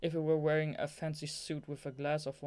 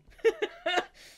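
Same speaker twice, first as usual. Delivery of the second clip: high-pitched giggling fit; real giggle; 8 dB louder than usual. Real, not acted. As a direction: burst into giggles at your own slip. Reader cracks up at own mistake.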